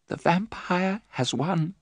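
slow, soft, high resignation; hopeless. Acted. Mina telling Van Helsing she is lost, slow and soft. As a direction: let the words fall in defeat.

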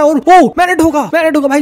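loud, high burst of triumph; excited shout. Real, not acted. Shouting as he rams the friend's plane, loud triumph. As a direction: yell as you smash him.

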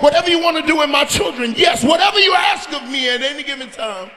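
loud, impassioned shouted surrender to God; full-force preaching. Real, not acted. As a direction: cry out yes to God at full volume.

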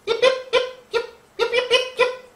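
very high, loud yips; yipping dog. Acted. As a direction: yip sharply to chase the sheep. Dog spooking the sheep.

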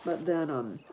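ordinary talk, nothing marked

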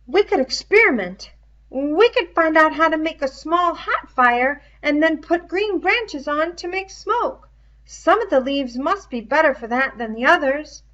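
put-on fussy, clever boy explaining eagerly; Piggy's voice. Piggy's dialogue in a reading.

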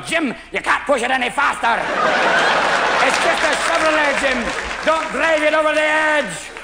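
high, strained warning, voice climbing; Scotty. Acted. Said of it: "Scotty's sitting next to you going".

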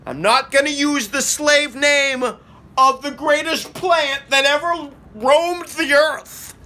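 loud, slow, high villain proclamation; booming demon lord. Acted. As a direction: boom it out like a dark lord. Mock speech against prohibitionists.

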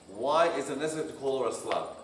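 ordinary talk, nothing marked

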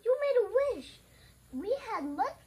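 high, loud accusing shout; story yell. A narrator's reading. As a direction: yell the accusation as the upset character. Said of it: "Garth yelled".

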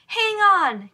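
high, loud startled gasp. A narrator's reading. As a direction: catch your breath in shock. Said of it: "Katie gasped".

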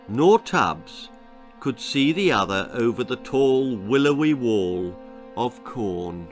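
usual speaking voice

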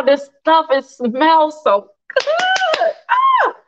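slow, high savoring of the smell; delighted by the smell. Real, not acted. Cook savoring the aroma to her viewers, delighted. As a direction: relish every word. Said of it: "You gotta come over here".

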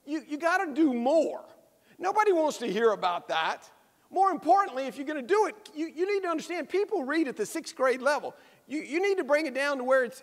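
a hint of an insistent teacher; teacher urging. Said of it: "A teacher approaches him and says".

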